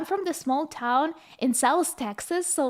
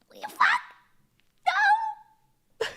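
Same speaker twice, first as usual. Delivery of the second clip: high squeaky mock panic; mock panic squeal; much slower than usual. To classmates, squeaky high mock despair. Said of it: "and we're like".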